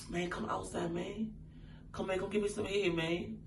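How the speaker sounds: imitating a man asking on the quiet; hushed proposition. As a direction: play him keeping his request discreet. Acts out man's furtive proposition.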